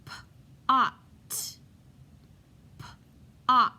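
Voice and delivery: "P-ot" split into p and ot, loud; sounding out words. Shown as a demonstration. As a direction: say "P-ot" as two separate sounds. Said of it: "blend the sounds".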